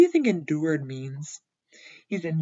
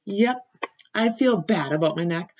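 usual speaking voice

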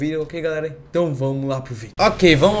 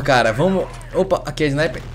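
ordinary talk, nothing marked

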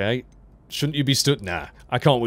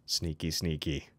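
mock evil; 6 semitones lower, 10 dB quieter, slower than usual. Sneaking into secret passage.

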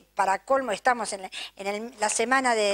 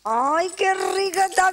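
higher, bright gush of delight over the food; 7 semitones higher than usual. Real, not acted. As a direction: squeal it like the first bite is a treat. Host tasting food on air. (encantada, más aguda, probando la comida al aire)

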